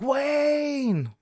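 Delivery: high, drawn-out call to a friend; shouted greeting. Retold or imitated. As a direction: imitate an over-the-top greeting holler. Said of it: "I would go like".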